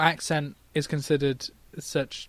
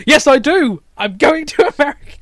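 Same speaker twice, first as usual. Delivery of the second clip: higher, louder, insistent comeback; defiant retort; 9 semitones higher, 7 dB louder than usual. Acted. Colin insisting to his doubting friend, defiant retort. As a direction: fire back, hammed-up and determined. Acting out Colin arguing with Tony.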